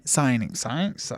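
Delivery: usual speaking voice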